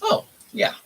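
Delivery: usual speaking voice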